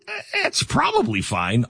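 slow, high-pitched mock nonchalance; dismissive shrug. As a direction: brush it off with a drawn-out high voice. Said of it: "Don't go with".

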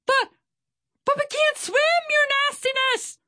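very high, squeaky pirate underling; stammer, then screech in panic; fearful screech. A narrator's reading. Swordfish protests to his captain, screeching with fear. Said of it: "Swordfish screeched with fear".

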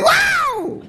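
a very high shouted "Wow"; excited shout. Retold or imitated. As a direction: belt it back at him full of joy. She answers the singer's call.

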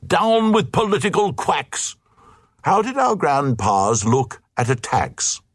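mock rallying shout; hammed-up protesting mob; crowd outcry. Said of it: "Then the Glugs cried".

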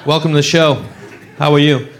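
ordinary talk, nothing marked

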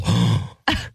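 sharp mock gasp of shock. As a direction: act out a wordless intake of breath. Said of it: "made me go".

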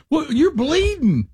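wife spots husband's bleeding arm, high alarmed mock cry; wife's shocked cry. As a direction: play the wife gasping at the blood. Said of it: "Like that".

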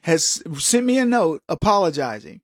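ordinary delivery

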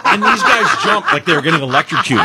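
high burst of laughter into the line; real laughter while retelling; laughing hard. Real, not acted. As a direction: laugh through the story. Cracks up telling story.

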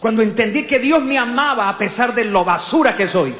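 loud, building sermon testimony; preaching at full force. Acted.

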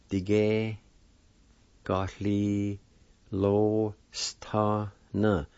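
long word split into small chunks with pauses; broken apart slowly. Shown as a demonstration. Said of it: "break those apart and just press them out real slow".

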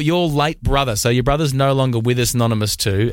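ordinary delivery

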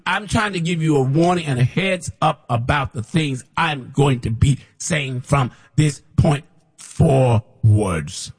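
ordinary talk, nothing marked

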